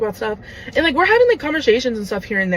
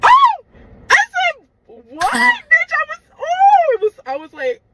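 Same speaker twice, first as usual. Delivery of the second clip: very high, loud outraged squeal; shocked shriek; 6 dB louder, slower than usual. Real, not acted. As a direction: shriek in disbelief. Outraged reaction to insult.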